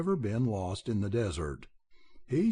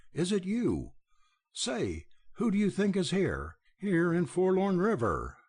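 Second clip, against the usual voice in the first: high, booming roar of joy; 7 semitones higher than usual. A narrator's reading. Belding greeting Dick, with a joyful roar. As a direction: bellow his name across the yard. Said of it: "he roared".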